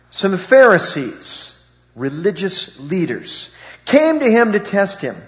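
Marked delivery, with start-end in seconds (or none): none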